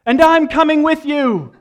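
hammed-up Sam with a laugh; dramatic Sam. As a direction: belt out loyal defiance. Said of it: "and he says".

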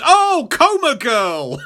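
high, slow dawning recognition; Spider-Man. Retold or imitated. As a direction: draw it out high, mock realisation. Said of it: "and he goes".

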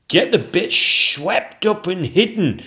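high, loud, drawn-out command; a foreman shouting instructions to the crew; shouted order. Acted. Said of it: "shouted the royal chief painter".

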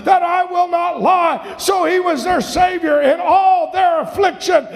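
high, shouted preacher's proclamation; fired-up pulpit delivery; full-force preaching. Real, not acted.

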